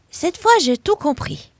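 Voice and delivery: proud eureka to herself; triumphant. Acted. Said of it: "se dit Rosie".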